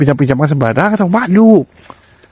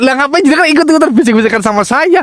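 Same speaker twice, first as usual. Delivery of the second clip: higher-pitched, insistent complaint; pitched-up, remonstrating tone; exasperated protest; 7 semitones higher than usual. Real, not acted. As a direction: object in exasperation at being drawn in. Protests at being whispered to.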